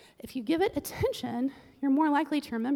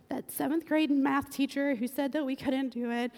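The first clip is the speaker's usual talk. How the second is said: usual speaking voice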